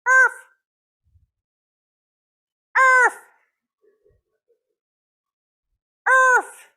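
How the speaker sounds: high, loud yappy dog barks; shrill yelping arf imitation; dog barking. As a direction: bark like a small dog next door. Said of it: "and you hear this".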